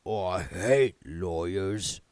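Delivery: a gloomy voice from beneath the chair; sad, hollow. A narrator's reading. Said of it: "It had a sad, hollow sound".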